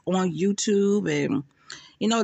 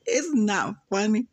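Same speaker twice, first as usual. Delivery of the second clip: ordinary delivery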